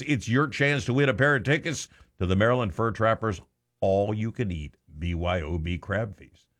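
ordinary delivery

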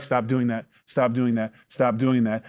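lightly acted mom, low and soft; mom's ineffectual nagging. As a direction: softly repeat it like an unheeded mother. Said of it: "the mom will be like".

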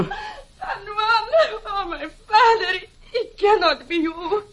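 sobbing disbelief at her father's voice; crying with relief. Acted. Daughter recognizes her father, crying with relief. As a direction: weep through the words, overwhelmed. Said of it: "it sounds as if somebody was crying".

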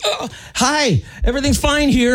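high, sing-song fake cheer; squeaky too-cheerful brush-off; mock innocent chirp. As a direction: pretend all is fine, way up high. Retold nervous reply to operator.

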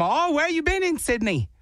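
high-pitched mock Australian accent; fake Australian accent. Husband quizzes travellers about Sydney, fake Australian accent. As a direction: ask it brightly as a pretend Australian. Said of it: "I'm from Sydney, Australia".

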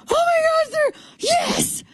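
very high, cracking cry of joy; ecstatic squeal. Retold or imitated. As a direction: shriek it like a fan meeting a star. Squealing climax of excitement.

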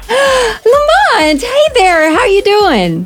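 high, loud happy welcome; excited greeting. Real, not acted. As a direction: greet him with delight.

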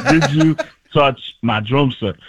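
slow, frog voice brought down; John C. Reilly. Shown as a demonstration. Comedian morphing Kermit into an actor, slow impression. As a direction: ask it slowly in a put-on voice. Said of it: "bring it down a little bit, becomes John C. Reilly".

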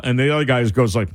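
ordinary talk, nothing marked

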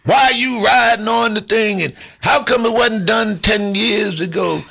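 normal talking voice

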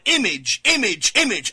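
"Image" hammered three times, each one falling; emphasizing image. Shown as a demonstration. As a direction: drive home "image" with every repeat. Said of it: "I'm emphasizing the word image".

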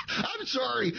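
high, soft, barely recovering from laughing; through laughter. Real, not acted. Host apologises for cracking up, voice high with laughter. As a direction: squeeze the apology out through giggles.

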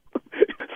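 quiet laugh. Real, not acted. Prankster breaks into laughter.

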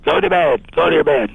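mild mock yell, pitched high; retold mother's yelling. Mother ordering Berta to bed from her bedroom, mildly imitated yell. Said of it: "she was yelling at Berta".

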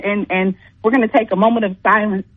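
low-pitched mock eulogy; mock solemnity. Caller holding a moment of silence for the losing team, mock solemn.

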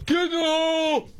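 high-pitched mock crowd shout; mock golf-fan yell. Host mimicking an obnoxious golf spectator, high mock yell. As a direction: imitate a heckler with a high, drawn-out holler. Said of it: "anybody that yells".

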